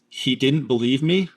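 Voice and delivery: "He didn't believe me" rising at the end like a question; question inflection. Retold or imitated. Analyst quotes the woman's statement as a question, question inflection. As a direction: lift "me" into a questioning rise. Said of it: "she says that in a question".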